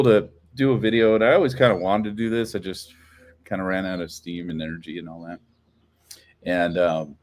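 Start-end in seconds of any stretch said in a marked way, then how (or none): none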